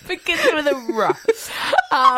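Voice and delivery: put-on giggling, sing-song to a child; laughing childish tease. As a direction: tease like a kids' game. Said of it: "Do you think I'm your child".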